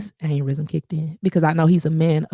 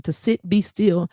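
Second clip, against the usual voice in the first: hushed meditative mantra; meditation voice; 4 semitones lower than usual. Shown as a demonstration. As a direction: breathe it out calmly. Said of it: "the real meditation".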